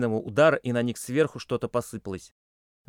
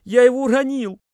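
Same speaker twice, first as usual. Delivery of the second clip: much higher, louder tearful wail; sobbing; 13 semitones higher, 11 dB louder than usual. A narrator's reading. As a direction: blurt it out through sobs. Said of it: "всхлипывая, пробормотал".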